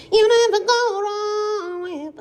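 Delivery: high, annoying put-on voice; irritating voice. Shown as a demonstration. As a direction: say it to annoy your sister. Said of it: "this is my irritating voice".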